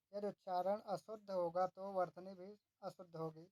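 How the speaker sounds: ordinary delivery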